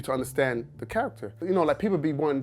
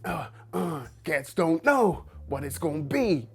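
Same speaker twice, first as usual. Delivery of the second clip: slow, gruff rap delivery in [name]'s voice; half-rapped [name] line; [name] impression; 3 semitones higher, slower than usual. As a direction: growl the lyric like [name].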